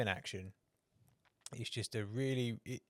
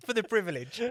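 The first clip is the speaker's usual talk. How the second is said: higher and louder, still laughing; laughing punchline; 9 semitones higher, 7 dB louder than usual. Real, not acted. Laughing as he tells his co-host the cider payoff. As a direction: squeeze the words out between laughs.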